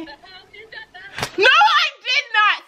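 high, slow gasp of disbelief; can't believe the good news; shocked cry to the realtor on the phone; stunned disbelief. Real, not acted.

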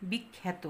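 বিখ্যাত said softly as 'bik-khæ-to'; æ-like vowel. Shown as a demonstration. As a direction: repeat বিখ্যাত quietly with 'a' as in 'cat'. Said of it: "উচ্চারণটা কি?".

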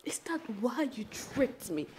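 slow whispered accusation; hushed, hurt question; slow bitter whisper; quiet accusation. Acted. Quiet accusation, then repeated loud.

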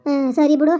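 loud furious tirade at an elder; angry scolding. Acted. Mother loudly scolds grandma for allowing the elopement. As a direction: tear into grandma, loud and relentless.

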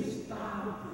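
high, strained whispery pleading; hammed-up desperate plea, high-pitched; mock screaming plea. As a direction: beg like exhausted body cells, without volume. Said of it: "every cell in my body is screaming".

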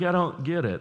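put-on a playful, teasing take on the girlfriend's puzzled voice; mock girlfriend voice. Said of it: "she's like".

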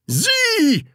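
very high, testy single word; irritated correction. Acted. Teacher correcting pupil yet again, irritated. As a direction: correct with frayed nerves. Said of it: "verbesserte Herr Groll gereizt".

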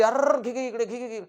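high, urgent backseat-driver yell; rapid high-pitched cries of alarm; panicked passenger. Acted. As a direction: frantically urge the driver to swerve. Voicing panicked passenger steering by hand.

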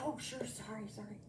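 soft, quick sorry sorry; a husband caught out, apologising meekly; quietly apologetic husband, lightly voiced; mild sheepish apology. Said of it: "so he said".

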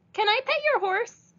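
put-on high, bright girl's introduction; little girl voice. A narrator's reading. Stella introduces herself to Leroy, high child voice. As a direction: pitch it up like a small girl. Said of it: "said Leroy".

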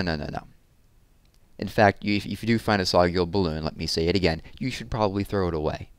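ordinary delivery

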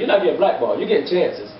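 ordinary talk, nothing marked

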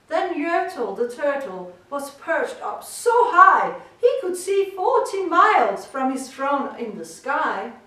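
ordinary delivery, nothing marked

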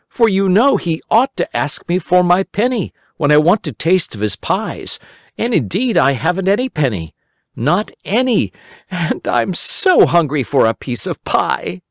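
put-on high, eager boy voice; a worried little boy pleading; Simple Simon. A narrator's reading. Simple Simon explaining to the rabbit, high boyish voice.

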